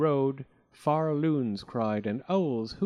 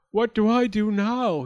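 raised, higher anxious question; worried boy voice; 9 semitones higher, 7 dB louder than usual. Acted. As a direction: ask it high and helpless. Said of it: "he asked".

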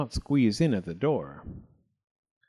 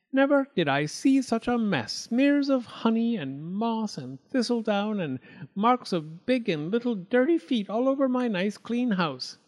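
higher, prim mouse voice; higher prim little mouse; fussy little mouse; 10 semitones higher than usual. Acted. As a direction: politely refuse the greedy guest. Mouse dismayed by mess.

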